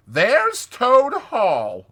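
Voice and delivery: slow, high, loud announcing; proud announcement. Acted. Rat shows Mole the house, proud announcement. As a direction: present it grandly, drawn out.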